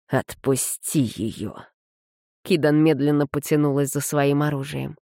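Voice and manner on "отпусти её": slow, low, quiet threat; low menacing command. Acted. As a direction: hold the rage down, slow. Suzunyos commands, full of rage.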